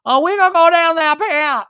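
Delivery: exaggerated, squeaky reality-star Brittany; Brittany accent. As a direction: go all in on the Brittany impression. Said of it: "my Brittany accent".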